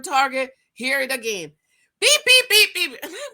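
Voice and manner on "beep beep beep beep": very high loud laughing beeps; imitated beep laughing. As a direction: squeak out the car chime through laughter. Imitating the car beeping again.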